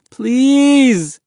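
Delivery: long, very high wail of a plea; begging. A narrator's reading. Boy still hanging on, begging at full cry. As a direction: stretch it into a loud desperate cry. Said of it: "Mahmud begged".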